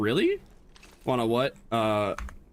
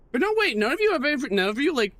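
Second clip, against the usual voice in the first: high, fast excited disbelief; can't believe it; 13 semitones higher, 3 dB louder than usual. Real, not acted.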